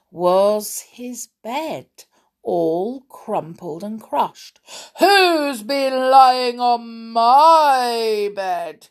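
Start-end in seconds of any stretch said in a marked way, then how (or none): 5.0-8.9 slow, loud, drawn-out bear roar; growling Father Bear. A narrator's reading. Father Bear seeing his bed crushed, growling. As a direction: growl it long and outraged. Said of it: "he growled".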